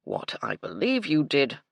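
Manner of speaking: a stiff, brittle butler; cool, correct, a little tight. A narrator's reading. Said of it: "in his brittle voice".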